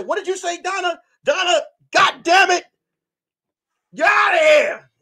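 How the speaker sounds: high-pitched scolding authority; imitated angry teacher. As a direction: act out a teacher losing patience.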